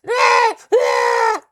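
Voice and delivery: shrill high panicked shrieks, very loud; goblin's terrified screams. Acted. Game master voicing the pinned goblin waking in terror, shrieking. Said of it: "He's like".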